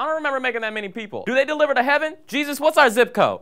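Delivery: high, loud, comic voice of God; hammed-up baffled God. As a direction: imitate a flustered God, rising to a loud cry. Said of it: "even God is like".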